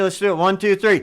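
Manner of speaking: shouted count to three; loud countdown. Real, not acted. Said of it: "Okay, ready?".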